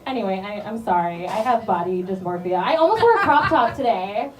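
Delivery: ordinary delivery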